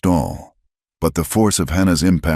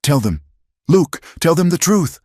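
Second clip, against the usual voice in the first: desperate plea; 14 semitones higher, 4 dB louder than usual. A narrator's reading. Hannah pleading desperately.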